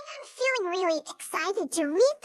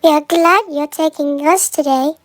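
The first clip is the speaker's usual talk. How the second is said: much louder, slowed thanks; loud grateful coaches; 2 semitones lower, 15 dB louder than usual. Acted. Coaches loudly thanking Edward for his gentleness. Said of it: "said the coaches".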